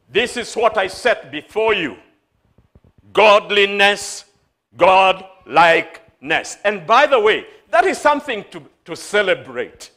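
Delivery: ringing, emphatic declaration; full-force preaching. Acted. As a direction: proclaim it firmly to the congregation.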